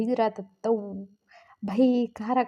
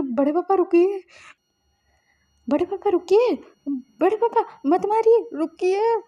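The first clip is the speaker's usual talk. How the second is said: high, louder pleading cry to stop; desperate plea; 8 semitones higher, 3 dB louder than usual. A narrator's reading. Nephew begs his uncle to stop beating Suyang, high desperate plea. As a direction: beg him to stop, voice climbing higher.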